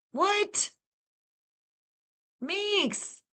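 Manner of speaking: high squeaky gasped disbelief; stunned surprise. Real, not acted. Streamer amazed at a viewer gifting memberships, high stunned gasp. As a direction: gasp out a name in shock.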